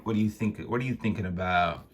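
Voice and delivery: ordinary delivery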